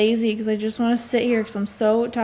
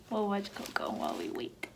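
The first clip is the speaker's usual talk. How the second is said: whisper; 13 dB quieter than usual. Real, not acted. Mother whispering to her daughter while waiting.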